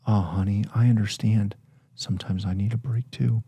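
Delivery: soft, consoling reply to a partner; tender, understanding murmur of sympathy; sympathetic. Acted. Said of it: "that's being sympathetic".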